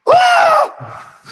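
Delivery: very high acted scream of pain; mock scream. Preacher acting out the arm being whacked off, mock scream. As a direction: shriek as if struck, acted.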